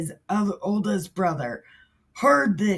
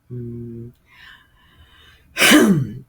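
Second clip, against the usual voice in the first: normal talking voice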